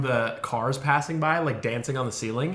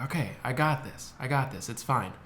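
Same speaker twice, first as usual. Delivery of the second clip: soft self-reassurance; 7 dB quieter than usual. Retold or imitated. Calming self down.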